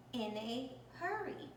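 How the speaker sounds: plain reading voice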